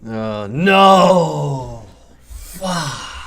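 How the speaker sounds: a put-on gruff growl, brief and odd-sounding; mock Roy Kent growl. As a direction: growl it like a grumpy English footballer. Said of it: "I thought actually something went wrong".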